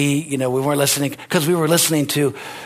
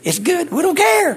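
carefree; 13 semitones higher than usual. Pretend. Shrugging off questions about ice cream, high and gleeful. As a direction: toss it off gleefully.

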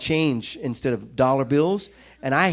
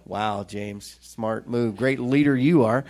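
sarcastic self-mockery; 5 semitones lower, slower than usual. Sarcastic aside mocking his own mistake.

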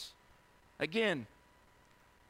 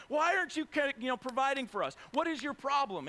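raised, higher-pitched griping; mock complaint; 7 semitones higher, 4 dB louder than usual. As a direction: demand answers like an aggrieved crowd. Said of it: "these people are all complaining to him".

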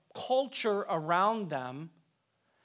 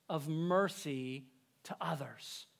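whisper; 2 semitones lower, 6 dB quieter, slower than usual. Real, not acted. Preacher drops to intimate whisper.

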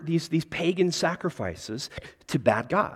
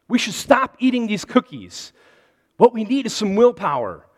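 raised, louder plea to stop; urgent insisting; 5 semitones higher, 5 dB louder than usual. Acted. Frog urging Toad to stop eating cookies, insistent. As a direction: urge a friend firmly, voice up.

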